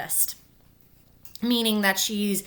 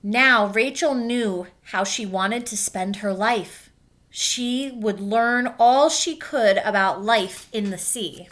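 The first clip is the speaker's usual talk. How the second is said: gentle read-aloud storytelling; clear, even picture-book narration; reading to children. A narrator's reading. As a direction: read it like story time for kids. Teacher reads picture book aloud.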